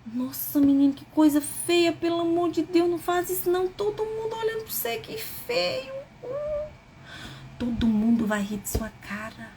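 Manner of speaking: lightly acted, shaming parent scolding a child; a touch of a fussy parent tutting at the kid; old-fashioned scolding parent. Old-school parent shaming a crying boy, mock tutting scold. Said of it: "os pais tinham mania de antigamente falar pros filhos assim".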